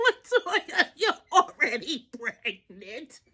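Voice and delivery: slow words broken by cackles; hysterical laughing. Retold or imitated. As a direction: imitate a doctor too tickled to talk. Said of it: "hysterically laughing, she was cackling".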